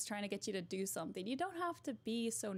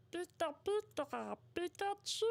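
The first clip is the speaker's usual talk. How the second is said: Pikachu voice; 7 semitones higher than usual. Pretend. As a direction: squeal it like Pikachu. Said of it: "request a Pikachu voice".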